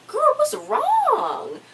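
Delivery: put-on squeaky high-pitched, drawn-out "girl, what's wrong"; very high squeal. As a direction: stretch it way up high and slow, mock concern. Comforting a crying friend, mock reaction.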